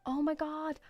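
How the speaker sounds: re-enacted "oh my god" moment of alarm; lightly acted shock, as if watching it float away; mock startled. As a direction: imitate a mildly startled reaction. Acts out alarm at escaping balloon.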